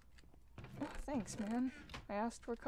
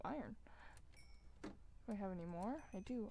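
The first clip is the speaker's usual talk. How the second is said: normal talking voice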